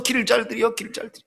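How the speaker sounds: quiet self-reminder dying away; murmured mantra fading. Retold or imitated. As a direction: mutter it to yourself and let it vanish. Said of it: "신혼생활 하면서도".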